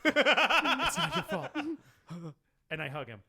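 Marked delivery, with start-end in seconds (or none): 0.0-1.5 slow, high, laughing through the words; laughing through comfort. Acted. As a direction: break up laughing while consoling.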